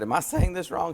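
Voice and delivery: high, anxious little question; checking his Spanish with a friend; worried question. Retold or imitated. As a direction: ask as if unsure you blundered. Asking the translator anxiously.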